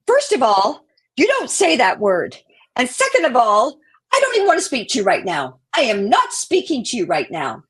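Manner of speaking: retold angry rebuke, emphatic and loud; indignant telling-off. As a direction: lay down the law, offended.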